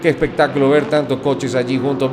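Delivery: ordinary delivery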